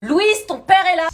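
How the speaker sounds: loud, high, drawn-out call. Acted. Mother calling her daughter when the father arrives. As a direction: call to someone in another room.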